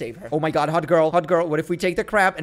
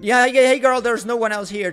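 mock-innocent, higher and louder; lightly acted flustered reassurance, pitched up; hasty cover-up; 7 semitones higher, 5 dB louder than usual. Player hides things as girl knocks, hasty cover-up.

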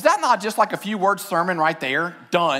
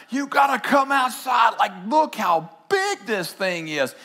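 urgent call; 4 semitones higher than usual. Retold or imitated. Runs inside calling family.

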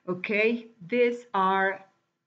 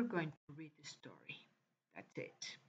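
whisper; 5 semitones lower, 17 dB quieter than usual. Real, not acted. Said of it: "whispered aside to class".

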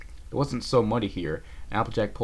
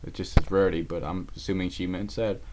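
ordinary delivery

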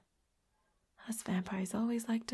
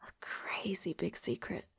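very soft, half-whispered secret; hushed confiding; 10 dB quieter than usual. Acted. Confiding a friend's secret to roommate, hushed. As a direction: drop to a near whisper.

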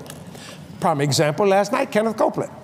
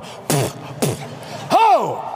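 acted spitting; much slower than usual. Retelling spitting scotch into the glass, gasping whoop. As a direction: spit twice and burst out "ho!". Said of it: "I went".

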